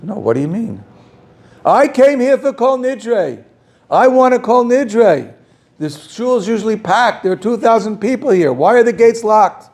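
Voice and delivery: frantic, nervous man demanding an answer. Retold or imitated. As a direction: plead desperately at the locked gates. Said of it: "He's agitated, he's frantic, he's nervous".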